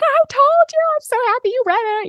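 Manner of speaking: high, bright gushing; excited friend. Retold or imitated. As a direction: gush like a thrilled friend. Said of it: "she's like".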